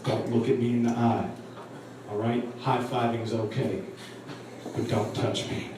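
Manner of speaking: low, soft, cool rapper voice; imitated laid-back guy, pitched low. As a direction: lay down ground rules smoothly and quietly. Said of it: "real smooth, real cool".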